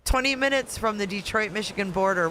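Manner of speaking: ordinary talk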